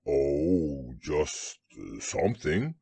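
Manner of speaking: low, hesitant bear; deep voice groping for an answer; vague Pooh. A narrator's reading. Bear has no real answer for Piglet, low and vague.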